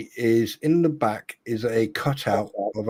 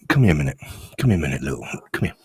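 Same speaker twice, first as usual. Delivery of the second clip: come-hither voice; 7 semitones lower, much faster than usual. Acted. Beckoning the troll in low voice.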